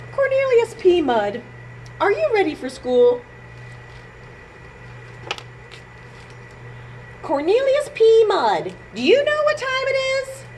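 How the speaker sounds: high calling parent voice. Acted. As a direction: call him from downstairs.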